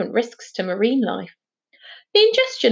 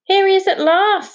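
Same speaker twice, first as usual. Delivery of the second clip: high, louder announcement; excited greeting; 11 semitones higher, 6 dB louder than usual. Acted. Charlie greets Finn arriving at the lantern room, excited. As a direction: call it out as he finally appears.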